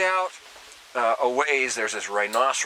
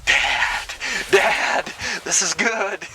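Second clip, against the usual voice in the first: high, raised call to Dad; excited son calling; 8 semitones higher, 3 dB louder, much slower than usual. Retold or imitated. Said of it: "And Ryan's going".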